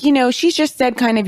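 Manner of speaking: ordinary talk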